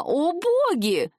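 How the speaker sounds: high, drawn-out horrified cry; appalled exclamation. A narrator's reading. Flora recognizing Agata, appalled exclamation. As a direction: stretch it high in shock. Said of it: "воскликнула она".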